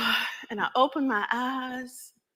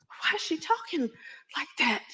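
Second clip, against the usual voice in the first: high, hushed worried question; a scared child whispering; child whispering to Mama; frightened whisper; 7 semitones higher, 5 dB quieter than usual. Acted.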